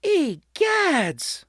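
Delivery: high shocked gasp of a word; startled exclamation. A narrator's reading. Mother seeing the creepy creatures, high and startled. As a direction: recoil in alarm. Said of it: "Mother exclaimed".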